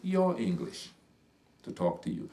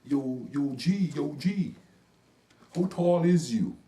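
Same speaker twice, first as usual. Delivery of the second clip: higher, louder, slow and friendly; street slang impression; 5 semitones higher, 6 dB louder, slower than usual. Imitating the kid asking how tall he is, high and drawn out. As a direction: stretch it out high like the grinning kid. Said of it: "he smiles big and he said".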